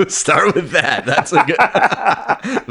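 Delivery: laughing hard, words breaking up. Real, not acted. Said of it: "I have two hands".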